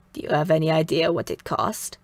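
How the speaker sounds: put-on low, raspy older woman's voice; raspy Mother. A narrator's reading. Mother quizzes the girl about her kimono, in a low rasp. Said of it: "in that raspy voice of hers".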